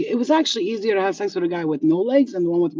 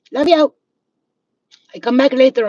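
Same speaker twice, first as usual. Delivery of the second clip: loud goodbye; 6 semitones higher, 10 dB louder than usual. Real, not acted. Loud farewell to viewers.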